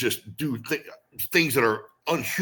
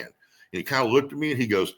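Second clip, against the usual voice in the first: ordinary delivery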